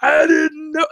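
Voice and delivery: put-on high, wailing kid protest; mock crying. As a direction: imitate a crying daughter's wail. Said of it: "she burst into tears and she cried".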